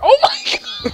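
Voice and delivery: very high, loud laughing scream; startled laughing shriek. Real, not acted. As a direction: shriek out at a sudden hit. Sudden hit in game.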